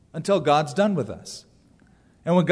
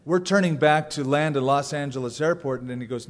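normal talking voice

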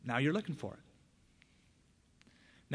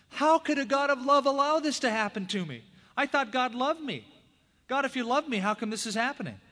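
higher, louder pleading complaint to God; anguished protest; 10 semitones higher, 4 dB louder than usual. Retold or imitated. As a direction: cry out at God in disbelief. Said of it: "you're prone to ask yourself".

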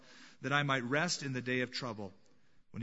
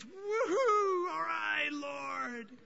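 whooping joy; 13 semitones higher, much slower than usual. Acted. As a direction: jump in the air for joy. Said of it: "Now imagine".

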